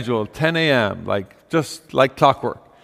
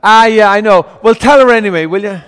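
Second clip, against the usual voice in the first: higher and louder, a lightly played insistent old woman; Mrs O'Brien's voice; 4 semitones higher, 8 dB louder than usual. Beggar woman insisting the boy fetch his mother, louder. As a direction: brush off the boy cheerfully, pitched up. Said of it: "And she says".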